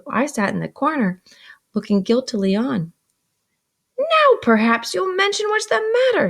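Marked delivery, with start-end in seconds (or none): 4.0-6.3 much higher and louder, breathless with anger; out of breath. A narrator's reading. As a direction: demand it after a struggle, panting. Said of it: "out of breath".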